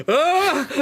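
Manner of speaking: loud high yelp; joking shriek. Pretend. Game master caps the gravity joke, loud playful shriek. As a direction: let out a high, playful scream. Joking outburst after the lyric.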